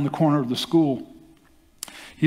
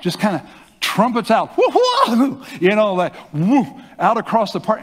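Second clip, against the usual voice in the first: high whooping trumpet of a sneeze, half laughing; elephant trumpet sneeze; 7 semitones higher, 4 dB louder than usual. Retold or imitated. Preacher imitating a trumpeting sneeze, high loud whoop. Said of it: "like an elephant".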